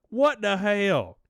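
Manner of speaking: ordinary delivery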